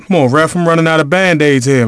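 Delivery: normal talking voice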